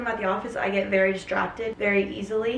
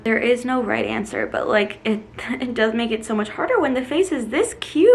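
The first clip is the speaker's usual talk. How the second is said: normal talking voice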